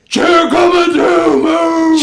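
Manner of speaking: high, loud, drawn-out holler; mock screaming. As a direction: imitate her screaming to clear the way. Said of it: "but she's screaming".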